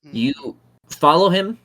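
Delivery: usual speaking voice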